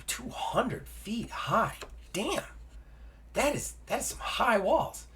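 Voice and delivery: high, soft, slow marvelling; amazed disbelief. Real, not acted. Player reacting to the height of city walls, amazed disbelief. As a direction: dwell on each word, impressed.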